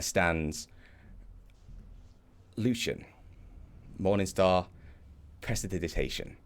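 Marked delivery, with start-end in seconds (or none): none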